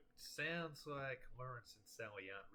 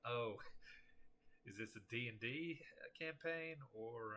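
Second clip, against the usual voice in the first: normal talking voice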